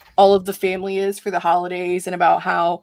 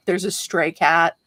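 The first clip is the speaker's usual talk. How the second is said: ordinary delivery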